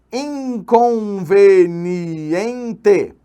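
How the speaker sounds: INCONVENIENTE drawn out very slowly, each syllable separate. Shown as a demonstration. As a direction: stretch every syllable of Inconveniente. Sounds out a candidate word.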